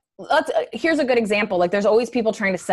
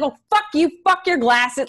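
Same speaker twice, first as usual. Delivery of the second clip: mock sudden anger; 6 semitones higher than usual. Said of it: "I'd be like".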